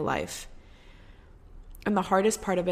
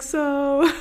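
nervous laugh; 9 semitones higher, 8 dB louder than usual. Retold or imitated. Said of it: "I was like".

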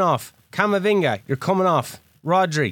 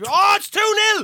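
high-pitched cry at a goal; excited outburst; 12 semitones higher than usual. Real, not acted. Player reacts to a second goal, excited cry. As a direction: burst out with the new score.

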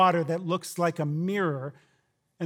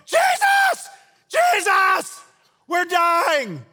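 frantic yelling, much higher and louder; panicked shout; 12 semitones higher, 7 dB louder, much slower than usual. Retold or imitated. Disciples shaking the sleeping Jesus awake, frantic shout. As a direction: scream him awake in terror. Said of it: "and they go".

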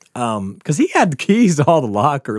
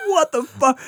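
pissed off; 13 semitones higher than usual. Retold or imitated. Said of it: "Jeff's like".

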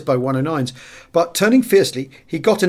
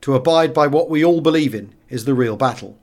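usual speaking voice

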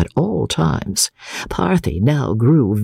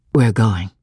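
ordinary delivery, nothing marked

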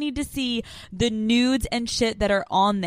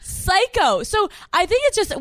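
excited squeal; 9 semitones higher than usual. Real, not acted. High squeal.

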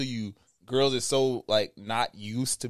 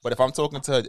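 normal talking voice

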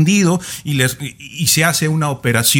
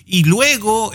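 ordinary delivery

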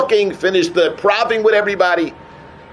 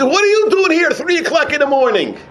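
much higher, astonished question; retold startled question; 8 semitones higher than usual. Visitor finding a girl crying at night, retold astonishment. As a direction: retell his surprise with pitch jumping up.

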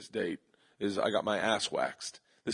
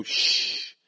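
shush. Acted. Shushing in the joke.

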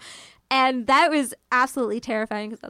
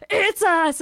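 higher, louder alarmed "It's us!"; shocked exclamation; 7 semitones higher, 7 dB louder than usual. Retold or imitated. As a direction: blurt the horrified realization. Thinks murder was at her hotel.

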